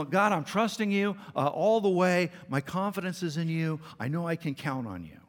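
loud, high-pitched pledge to God; eager prayer, easing at the end; emphatic declaration of faith. Acted. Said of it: "quick to express our faith in God".

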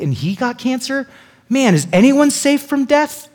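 high-pitched, rising incredulous question; acted astonishment, pitch leaping up; shocked disbelief. Friend hearing a healthy guy got cancer, incredulous. Acts out shock at death news.

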